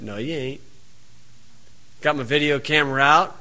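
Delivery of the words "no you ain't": soft, low, easygoing refusal; smiling calm. Retold or imitated. Preacher to the chicken inspector, calm and soft. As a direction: say it with a smile, unbothered. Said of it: "I just smiled and said".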